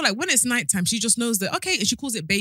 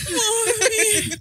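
high mock sob with a big gasp; gasp, then wail like a child, hammed-up; imitated breathless crying; 12 semitones higher than usual. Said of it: "can you breathe in and out".

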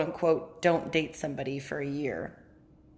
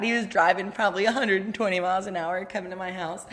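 ordinary delivery

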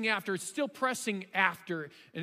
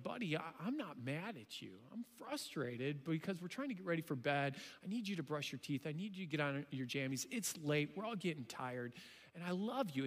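softer, lower fatherly voice; gentle reassurance; 5 semitones lower, 9 dB quieter than usual. Retold or imitated. Dad soothing his upset eight-year-old at bedtime, gentle reassurance. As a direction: talk him down softly and tenderly.

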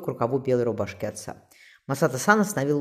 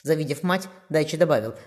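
ordinary delivery

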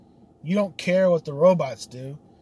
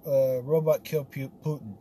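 normal talking voice

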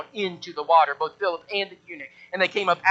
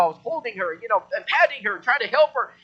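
ordinary talk

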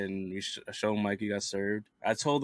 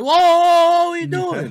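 loud, high, alarmed cry; startled shout; 14 dB louder than usual. Retold or imitated. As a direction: shout it like you just got startled. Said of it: "He's like".

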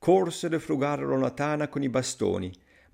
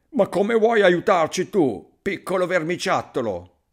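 scornful question; 6 semitones higher, 5 dB louder than usual. A narrator's reading. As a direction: jeer at a puny would-be helper. Thieves scoff at tiny helper.

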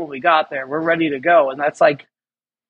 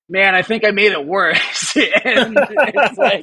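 sheepish laughing confession; laughing at yourself, higher; 5 semitones higher, slower than usual. Real, not acted. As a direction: giggle through admitting it. Laughing at own mistake.

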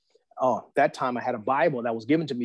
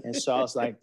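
normal talking voice

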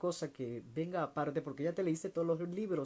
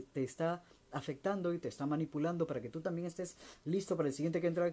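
ordinary talk

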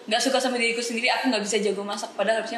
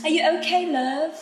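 higher, lilting British-accented greeting; British endearment; 7 semitones higher, 4 dB louder, slower than usual. Shown as a demonstration. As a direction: check in breezily in a British accent. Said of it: "ngomong darling love itu". (sapaan sayang logat British, nada naik)

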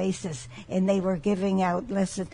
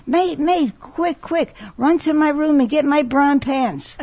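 higher, louder, panicked captain's yell; mock panicked scream; 6 semitones higher, 6 dB louder, slower than usual. Captain sees a third pirate ship, mock panicked yell. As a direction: scream for the mate in a hammed-up panic. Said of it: "He's screaming".

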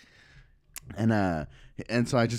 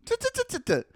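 very high rapid tuts; goading mock hush; condescending tutting. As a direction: cut them off with squeaky little tuts. Shushing angry worker mockingly.

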